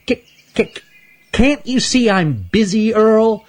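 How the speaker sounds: high, stammering squirrel voice; stuttering squirrel. Acted. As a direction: stutter it out, flustered and squeaky.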